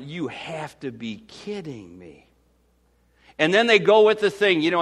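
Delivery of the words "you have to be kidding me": low, quiet, slow disbelieving mutter; a slow, quiet "you have to be kidding me"; disbelief. Retold or imitated. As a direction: let it sink in, stunned and quiet. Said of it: "I went".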